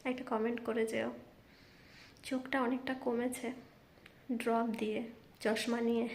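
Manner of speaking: soft, hushed night-time voice; speaking softly. Real, not acted. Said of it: "রাতের বেলা বলে আমি আস্তে কথা বলছি".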